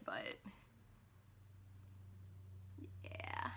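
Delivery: soft, whispered afterthought; whispered aside. Real, not acted. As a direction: let it fade to a whisper. Trailing off softly.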